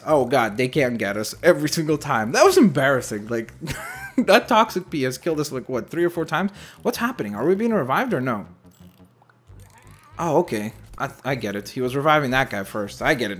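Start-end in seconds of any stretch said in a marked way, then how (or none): none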